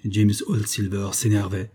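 ordinary delivery

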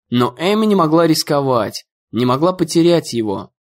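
ordinary delivery, nothing marked

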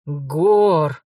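exclaiming. Acted. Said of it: "воскликнул".

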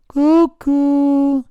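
high sing-song cuckoo jeer; mocking taunt. A narrator's reading. Puppet teasing the boys who threaten him, mocking. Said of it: "in segno di canzonatura".

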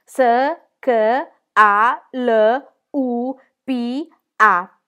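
very slow spelling, long gaps between letters; reading aloud haltingly. Acted. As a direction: spell each letter, one at a time. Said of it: "come si compita".

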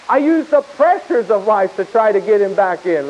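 ordinary talk, nothing marked

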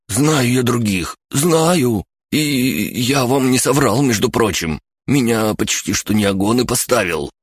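high, slow, wheedling confession; pleading. A narrator's reading. Captive confesses to his captors, pleading. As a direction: plead to be believed, easing off towards the end. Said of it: "Он сделал умоляющее лицо".

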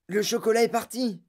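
high, excited cry; thrilled grandfather's exclamation; exclaiming. A narrator's reading. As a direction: cry out in amazement, pitch well up. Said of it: "s'écria grand-papa Joe".

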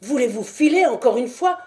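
cri plus fort et plus haut; crié. Acted. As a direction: chasser l'intrus d'un cri. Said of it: "cria la voix". (vieillard qui crie à l'intrus de partir)

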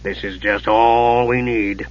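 slow, low grumble; grudging, dragged-out mutter; muttered displeasure. A narrator's reading. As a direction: grumble it sourly, drawing it out. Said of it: "eyeing the mage with no great pleasure".

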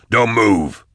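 a firm yell, not full force; yelled command. A narrator's reading. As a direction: bark it to freeze the gunman. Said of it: "Nayl yelled".

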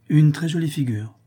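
a touch of admiring excitement; admiring exclamation. Acted. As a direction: praise it to get a reaction. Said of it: "s'écria la comtesse".

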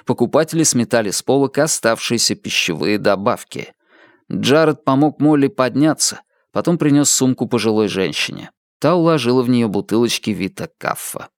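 usual speaking voice

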